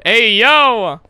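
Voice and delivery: loud, high, stretched yell; shocked callout. Real, not acted. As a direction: holler it in playful shock. Mock shock at chat message.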